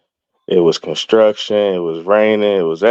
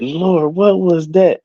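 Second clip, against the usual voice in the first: rising much higher in amazement; high, astonished question to the Lord; retold astonishment; 10 semitones higher, slower than usual. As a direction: ask it wide-eyed, stunned and awed. Astonished after the divine voice.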